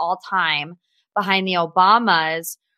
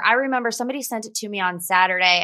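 ordinary talk, nothing marked

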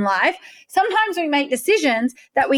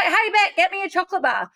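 much higher, casual mock call to Beck; imitating the friend; 8 semitones higher than usual. Friend calling across the street for chocolate, mock high voice. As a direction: toss off the request in a pitched-up friend voice. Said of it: "He was like".

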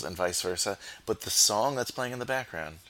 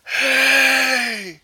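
huge sudden yell, much higher and louder; barking gym coach; 13 dB louder than usual. Retold or imitated. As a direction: bellow it across the weight room. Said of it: "our coach yelled from across the weight room".

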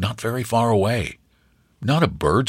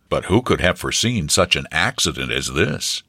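ordinary delivery

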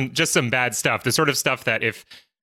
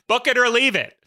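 mock shout; 13 semitones higher, 3 dB louder than usual. Joining the mock wife yell.

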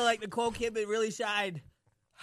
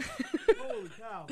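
slow high laughing groan; pained laughing groan; much slower than usual. Real, not acted. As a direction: laugh through the burn. Pain laugh.

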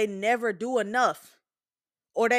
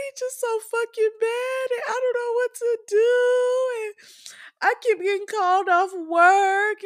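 imitating a crying friend; 8 semitones higher, 3 dB louder than usual. Friend venting about daycare and work, mimicked in a high weepy whine. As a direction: whine it all out like she's at the end of her rope. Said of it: "she called me crying".

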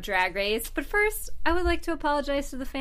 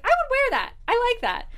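much higher excited voice; high gushing self-quote; 13 semitones higher, 3 dB louder than usual. Retold or imitated. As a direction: squeal it like you're smitten. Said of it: "I was like".

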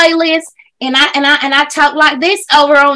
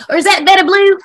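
ordinary delivery

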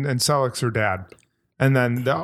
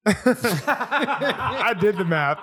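laughing; 6 semitones higher, much slower than usual. Real, not acted. Laughing at own math joke.

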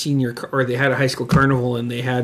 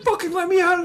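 mock frantic demand. Imitating friend locked in sound room, high mock-frantic demand.

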